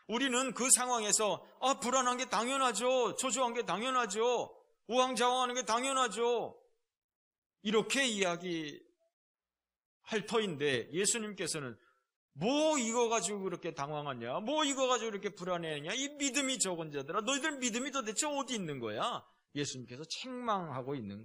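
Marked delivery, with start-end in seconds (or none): 1.6-6.6 anxious excuse. Pretend. Our anxious excuse voiced. 12.3-19.2 scolding rebuke. Pretend. Jesus scolds, paraphrased.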